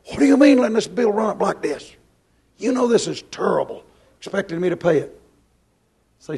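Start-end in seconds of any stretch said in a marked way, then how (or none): 0.1-5.2 scolding. Retold or imitated. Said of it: "opens the door and says".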